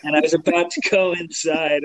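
usual speaking voice

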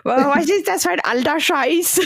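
höher, verächtlicher junger Spott; abfällige Jugendliche. Pretend. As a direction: wie ein genervter Teenager. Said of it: "Und dann alle jungen Leute". (höhere, abschätzige Jugendstimme)

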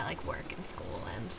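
whispered, drifting off; whispering. Real, not acted. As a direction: let it fade to a whisper. Overwhelmed, voice drops away.

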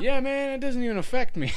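high, loud and laughing; mock the stoned driver's confidence; laughing stoner driver. Retelling the driver who claims he's fine, high laughing voice.

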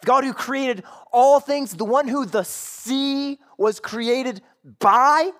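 high, loud scolding, slowing hard at the end; emphatic accusation. Acted. Sailors to Jonah, your fault, loudly accusing. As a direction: pin the blame on them, hitting the key words. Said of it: "The same is true here".

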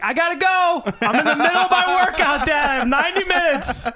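high, loud mock exasperated shout; annoyed yelling at dad. Annoyed son brushing off dad.

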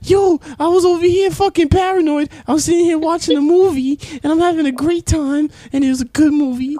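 very high, loud panicked mom voice; hammed-up mother reliving her paranoia; a mom telling her son how high she got, in a shrill mock voice; imitating mom. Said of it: "She's like".

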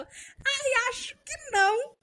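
high, drawn-out whiny imitation of a friend; mocking whiny voice. Doubting friend mimicked in a high whiny voice. Mocking Giovana's hesitant reply.

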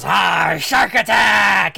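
drawn-out roared catchphrase; slow wrestler holler; Shark Attack's battle cry. Acted. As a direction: roar your own name at the crowd.

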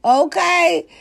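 loud, high sassy callout; sassy hype. Real, not acted. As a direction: hype them up with attitude.

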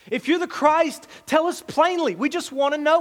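high, pressing demand from the crowd; insistent, demanding questioners. Acted. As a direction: push him for a straight answer. Crowd demands Jesus answer plainly.

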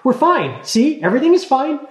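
high, rushed, overly cheerful; hasty reassurance. A narrator's reading. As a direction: gabble to cover it up. Talia hastily reassures Linus.

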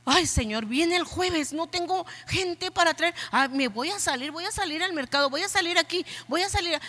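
a touch of panic, voice climbing; fretting. Retold or imitated.

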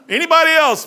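high, loud burst; loud exclamation. Real, not acted. Preacher marvelling at George, loud and high. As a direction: throw it out big.

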